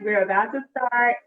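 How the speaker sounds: normal talking voice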